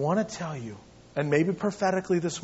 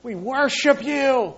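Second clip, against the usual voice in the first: slow, higher, earnest praise; calm worship; 8 semitones higher, 6 dB louder, slower than usual. Pretend. Paul and Silas calmly worship God in the prison. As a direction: sing out praise steadily. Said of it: "You hear Paul and Silas".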